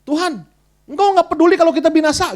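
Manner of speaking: high, loud whiny complaint; grumbling at God. Retold or imitated. Disciples in the storm nagging Jesus, loud whiny grumble. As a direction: scold the Lord like frightened fishermen. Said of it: "Mereka ngomel sama Tuhan".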